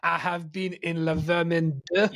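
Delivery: put-on comic French accent, haltingly phrased; hammed-up French impression. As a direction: play a Frenchman leaving a voice note. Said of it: "when you try and do a French accent".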